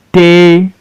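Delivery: teacher sounding out "Table" in two separate beats; broken into syllables. Shown as a demonstration. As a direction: say "Table" one drawn-out syllable at a time. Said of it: "So you break it into syllable".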